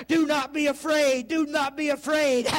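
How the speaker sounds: driving, rhythmic sermon delivery; fervent preacher building in pitch and intensity; full-force preaching. Real, not acted. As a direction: preach it hard, punching each phrase.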